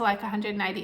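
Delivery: usual speaking voice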